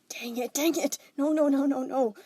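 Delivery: high, loud desperate no's; panicked outburst. Acted. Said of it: "He was stuttering, breathless".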